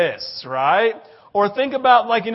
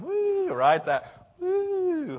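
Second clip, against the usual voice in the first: Steve Martin; 11 semitones higher, much slower than usual. Retold or imitated. Steve Martin woo imitation.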